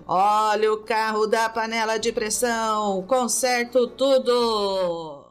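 loud, drawn-out hawker cry; street vendor call. Acted. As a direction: belt it like a repair truck's loudspeaker. Teacher acts the pot-repair car. (chamada de vendedor ambulante)

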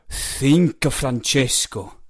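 lightly voiced master, urging gravely. A narrator's reading. Master to hesitant boy at the launch, exhorting.

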